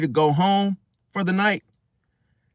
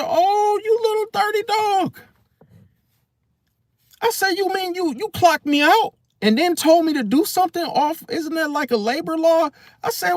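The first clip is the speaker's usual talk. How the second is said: much higher, squeaky indignant voice; hammed-up shocked outrage, pitched way up; mock outraged squeal; 11 semitones higher than usual. Telling the manager off in a much higher squeal.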